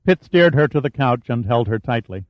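ordinary delivery

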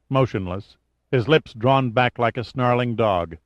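ordinary delivery

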